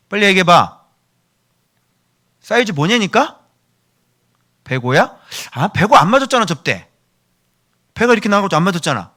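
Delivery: acted size squabble, voice up; impatient nagging. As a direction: press for an answer, exasperated. Acts out impatient quarrel over size.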